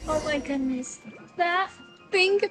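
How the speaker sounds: ordinary delivery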